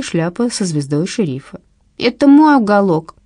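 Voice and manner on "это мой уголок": put-on high, loud child voice; little boy. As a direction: play a small boy guarding his spot. Little boy claims his corner.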